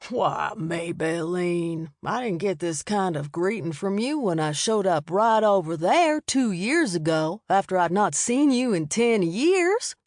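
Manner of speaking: slow, drawled friendly greeting; a charming good old boy sidling up; easygoing Southern man. A narrator's reading. Bobby Ray voiced by narrator.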